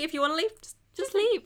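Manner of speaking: high, rising sarcastic just leave; mock it sarcastically. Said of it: "how abusive relationships work".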